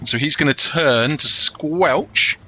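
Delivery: ordinary talk, nothing marked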